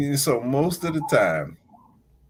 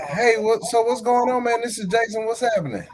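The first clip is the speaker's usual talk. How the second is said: higher, upbeat cheerful hello; breezy greeting; 9 semitones higher than usual. Retold or imitated. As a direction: greet the caller all friendly and bright. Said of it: "I was like".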